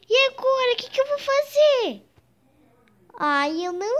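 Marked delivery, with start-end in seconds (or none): 0.1-2.0 panicked. Acted. Panics about being a baby.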